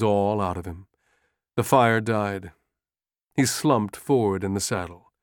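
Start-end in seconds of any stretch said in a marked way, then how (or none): none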